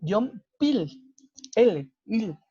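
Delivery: Yompil ending in a clear l, il; final L demo. Shown as a demonstration. Teacher shows students the l in Yompil. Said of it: "funciona como L".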